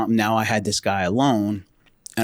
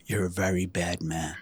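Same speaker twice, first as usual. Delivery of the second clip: low, soft retold reproach; hushed imitation of a cowed man; meek accusation; 5 semitones lower, 8 dB quieter than usual. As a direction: say it quietly, low in the voice. Subdued man retold after being knocked out.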